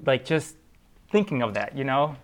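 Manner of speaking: normal talking voice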